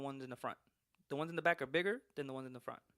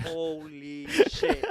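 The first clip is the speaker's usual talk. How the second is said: mock shock; 2 semitones higher, 8 dB louder, much slower than usual. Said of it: "And I'm like".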